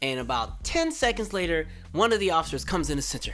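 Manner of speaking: ordinary delivery, nothing marked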